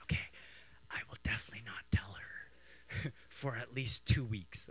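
hushed promise to keep a secret; conspiratorial whisper. Retold or imitated. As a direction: whisper back like a co-plotter. Son agreeing to keep secret.

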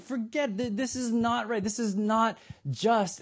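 high-pitched indignant protest; angry outrage. Acted. Six o'clock workers protest the others' equal pay, angry. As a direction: protest the unfairness, pitched well up and emphatic. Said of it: "there's one group who's angry".

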